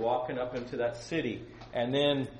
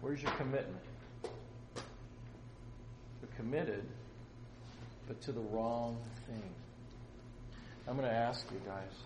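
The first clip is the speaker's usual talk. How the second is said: soft, halting, with long pauses; hushed solemn appeal; 10 dB quieter than usual. Real, not acted. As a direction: drop the volume and let each question sink in. Solemn closing appeal to listeners.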